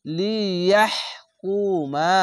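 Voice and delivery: লিয়াহকুমা chanted slowly, vowels drawn long; Quranic recitation. Shown as a demonstration. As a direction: recite slowly, stretching each syllable. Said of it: "হা উচ্চারণ করতে গেলে". (ধীরে টেনে তিলাওয়াত)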